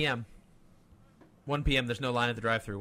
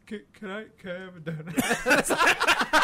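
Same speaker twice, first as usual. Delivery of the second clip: pleading roommate; 4 semitones higher, 4 dB louder, slower than usual. Retold or imitated. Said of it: "He goes".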